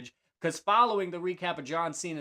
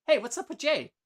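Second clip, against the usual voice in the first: high, louder mock-teasing question; cheeky teasing; 10 semitones higher, 4 dB louder than usual. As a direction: needle her with a high, cheeky voice. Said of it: "real cheeky".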